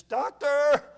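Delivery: high, loud call for the doctor; a patient in pain calling out; mock cry to the doctor; woman crying out in pain. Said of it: "Her scream sent chills up my spine".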